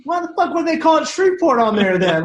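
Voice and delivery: high-pitched mock exasperation; baffled outburst. Storyteller voicing a musician baffled by tour itinerary, mock exasperation. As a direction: retell it as an incredulous, high-pitched punchline.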